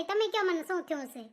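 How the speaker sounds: usual speaking voice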